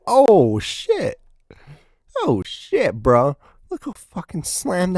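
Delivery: high, startled oh shit; a squeaky shocked exclamation; shocked squeal. Real, not acted. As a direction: yelp it in disbelief. Reacting to a slammed car.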